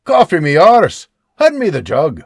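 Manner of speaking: loud rough bark for the jug. Acted. Old man loudly demands the jug instead of coffee. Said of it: "Beebe barks".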